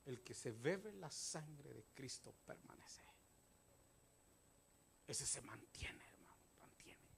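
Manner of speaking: low, half-whispered exhortation; hushed preaching. Real, not acted. Preacher urging the congregation to persevere, hushed. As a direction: preach it softly and slowly, almost in a whisper.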